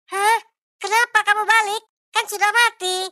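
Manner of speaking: high, loud frightened pleading; scared wife. Acted. Wife refusing her dead husband's ghost, high frightened voice. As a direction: push the ghost away in a high panic.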